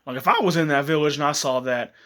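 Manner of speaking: usual speaking voice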